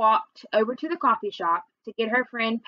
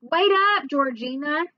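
high call to wait up; whiny little brother calling after sister; griping holler; 4 semitones higher than usual. A narrator's reading. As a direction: holler it, pitched up at first. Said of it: "Toby kept gripping and hollering".

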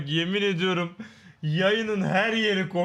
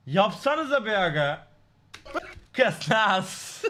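ordinary talk, nothing marked

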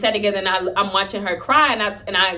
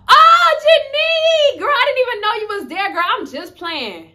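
much higher, louder mock-sweet gush, trailing to slow; fake surprise; 14 semitones higher, 7 dB louder than usual. Caught sister greets Janique with hammed-up fake sweet surprise. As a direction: play innocent once you're caught.

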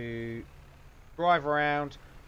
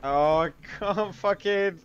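frustrated groan; 6 semitones higher, 9 dB louder, slower than usual. Real, not acted. Frustrated at game.

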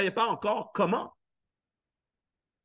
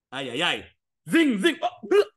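mock crash noises; 3 semitones lower than usual. Recounting his scooter crash in Paris, playful sound effects.

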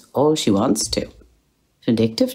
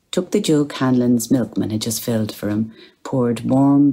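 usual speaking voice

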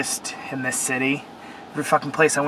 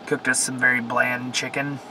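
ordinary talk

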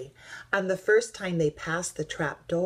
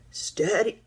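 raised starting call; shout; 5 semitones higher, 4 dB louder than usual. A narrator's reading. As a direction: call out the countdown. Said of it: "shouted Duck".

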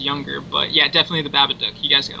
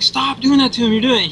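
scolding mom; 8 semitones higher, 4 dB louder than usual. Retold or imitated. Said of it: "my mom would be like".

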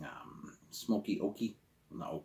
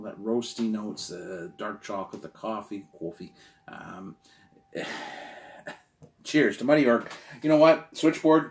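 ordinary talk, nothing marked

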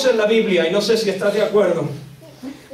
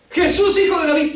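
high, carrying street cry; imitated crying out; 8 semitones higher than usual. Woman follows Jesus down the street, imitated crying out. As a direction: cry out high after someone walking ahead. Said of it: "clamando, en medio de la calle".

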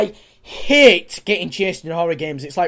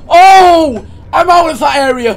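loud, higher cry of relief; relieved yell; 6 semitones higher, 9 dB louder than usual. Real, not acted. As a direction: burst out in relief. Escaping water monster, relief.

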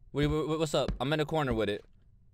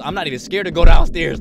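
normal talking voice

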